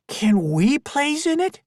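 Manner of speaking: put-on very high, loud eager boy; a kid bursting to play; excited. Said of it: "Jabir asked in excitement".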